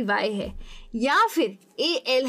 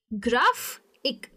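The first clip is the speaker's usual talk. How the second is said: "Graphic" slowly split, GRAF... ik; slow syllable demo of "graphic"; stress on first syllable; much slower than usual. Shown as a demonstration. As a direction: lean on GRAF, pause before IK in "graphic". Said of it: "पहले वाले पे किया है".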